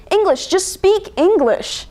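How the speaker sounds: high, slow, frustrated insistence; fed-up son correcting his mother; mounting exasperation. Acted. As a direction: repeat the demand with rising irritation. Son repeats demand, more frustrated.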